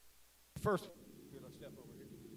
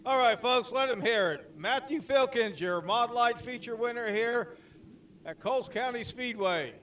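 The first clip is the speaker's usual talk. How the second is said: crowd announcer; 4 semitones higher, 3 dB louder than usual. Real, not acted.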